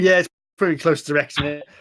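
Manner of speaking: usual speaking voice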